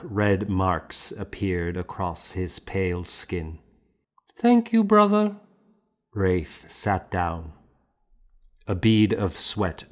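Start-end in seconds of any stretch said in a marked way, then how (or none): 4.4-5.4 high, lightly voiced boy; a boy answering his teacher in a higher voice; boy's meek thanks. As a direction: thank the master after the blows.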